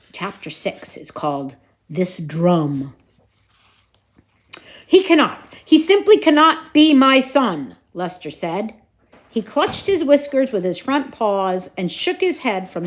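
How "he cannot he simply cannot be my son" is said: despairing outburst. Acted. Distraught father mouse outburst.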